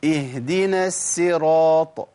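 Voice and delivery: اهدنا الصراط slowly, with a thin س for ص; sad softened to sin. Shown as a demonstration. Teacher imitating a common Fatiha mistake, sad turned to sin. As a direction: chant الصراط with a plain s sound. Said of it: "إبدالها بسين بيبقى أسهل".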